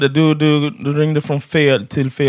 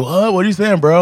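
higher puzzled surfer voice; lightly voiced surfer dude, pitched up; 6 semitones higher than usual. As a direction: play a confused surfer dude. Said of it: "it sounded like a surfer dude".

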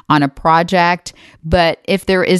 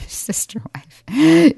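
whisper; 11 semitones higher, much slower than usual. Real, not acted. Laughing aside about sister wife.

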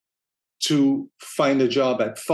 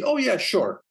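a bright, higher "oh yeah, sure"; mild mock cheerful agreement; lightly voiced eager contact; 8 semitones higher than usual. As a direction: toss it off like a happy-to-help friend. Voicing a helpful contact.

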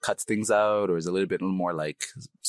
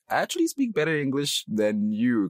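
higher, playful one-upping comeback; proud retort; 8 semitones higher than usual. Acted. Newcomer retorting to Canadians' praise, playfully indignant. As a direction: push back with mock indignation.

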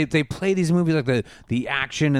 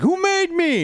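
plaintive robot; 13 semitones higher, 3 dB louder, slower than usual. Retold or imitated. Optimus searching in space.

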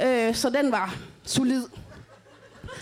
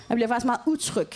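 ordinary delivery

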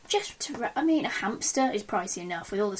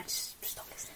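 whisper; 16 dB quieter than usual. Real, not acted. Joking aside to American listeners.

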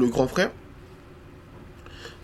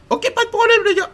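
very high, far-off squeaky voice; squeaky impression; 5 dB louder than usual. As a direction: squeak it like a cartoon friend. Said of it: "Il a vraiment pas ça".

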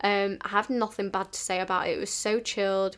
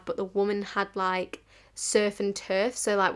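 usual speaking voice

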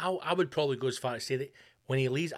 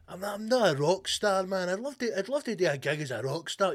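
lightly voiced Trump, wistful boast; a hint of Trump daydreaming about rock stardom; mock Trump voice; 6 semitones higher, 3 dB louder than usual.

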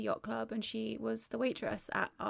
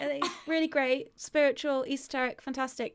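high, louder mock patience turning demanding; mock polite then demanding; 3 semitones higher, 6 dB louder than usual. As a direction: humour him then push. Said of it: "I was like".

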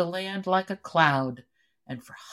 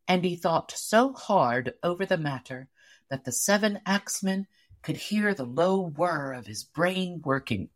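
ordinary delivery, nothing marked

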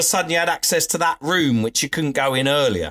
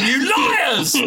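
imitating an angry bloke; 12 semitones higher than usual. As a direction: rant at them as if they're lying to you. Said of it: "he was really angry and annoyed".

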